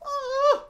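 sudden high, loud "aah"; frustrated shriek. Real, not acted. Player fed up with poor packs, frustrated shriek.

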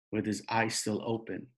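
usual speaking voice

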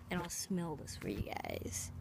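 unvoiced whisper. Real, not acted. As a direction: whisper it to the viewers. Whispered aside.

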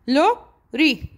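لوري broken as لو, ري; split into syllables. Shown as a demonstration. Teacher counting for pupils, لوري in two slow beats. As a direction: pause halfway through لوري. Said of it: "إذا two syllable".

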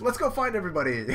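high, quick perky cartoon voice; rally friends in a little sidekick voice; cheerful Chopper. Chopper cheers, then reader repeats.

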